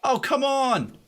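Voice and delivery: real gamer rage at a death; loud, high "oh, come on!"; frustrated outcry. Real, not acted. Frustrated outburst at game death.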